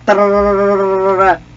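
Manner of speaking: loud, high rolled rat-a-tat; mock gunfire noise. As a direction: trill out pretend machine-gun fire. Imitates gunfire while playing.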